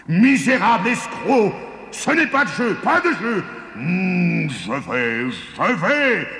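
high, strangled giant fury; exploding with rage. A narrator's reading. Cheated giant accuses the farmer, exploding with rage. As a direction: splutter and screech like an outraged giant. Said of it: "Le géant explosait de rage".

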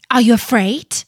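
loud, harsh taunting question; harsh challenge. A narrator's reading. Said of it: "said to him in a harsh voice".

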